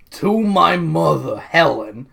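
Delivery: slow, drawn-out zipper voice. Shown as a demonstration. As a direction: read it like a cartoon zipper. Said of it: "I started reading this in a zipper voice".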